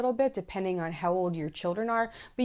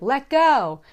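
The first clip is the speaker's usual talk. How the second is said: higher, louder call up the wall; belayer calling up; 7 semitones higher, 9 dB louder than usual. Pretend. Belayer at the bottom urging the climber, louder call.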